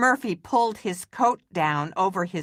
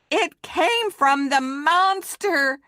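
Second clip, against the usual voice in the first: higher, slower scared cry; frightened cry; 9 semitones higher, 4 dB louder than usual. Acted. Murphy crying out about the monster, frightened. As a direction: wail it out in alarm. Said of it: "cried Murphy".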